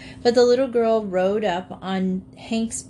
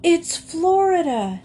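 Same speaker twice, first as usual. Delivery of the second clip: high, loud, slow triumphant shout; 10 semitones higher, 5 dB louder than usual. A narrator's reading. Jinx shouting to the animals on reaching Florida, triumphant. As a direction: announce it to the whole valley. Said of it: "shouted Jinx".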